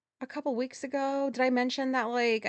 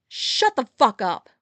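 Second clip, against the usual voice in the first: angry snap; 9 dB louder, slower than usual. Retold or imitated. Worker snaps back in quarrel.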